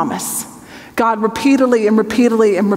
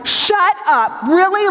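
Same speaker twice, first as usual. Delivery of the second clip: high, delighted "shut up, really"; like he just won a prize; thrilled disbelief; 8 semitones higher than usual. Retold or imitated. Abraham hearing he'll have a son, thrilled disbelief. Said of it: "Abraham's like".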